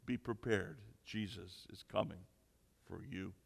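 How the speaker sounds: low, hushed prophecy of doom; ominous bird. Acted. Caged bird warning the burglar, low and hushed. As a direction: drop the voice and fade away ominously. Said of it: "The bird says and looks at him".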